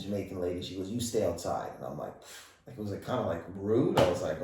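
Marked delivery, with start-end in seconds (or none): none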